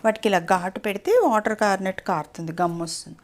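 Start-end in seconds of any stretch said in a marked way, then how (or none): none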